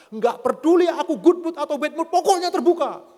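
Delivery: high, loud mock-angry outburst; imitating furious wife. Wife overruling her husband's excuses, loud mock fury. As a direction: cut him off with a hammed-up wifely yell. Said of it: "Dia marah terus dia bilang gini".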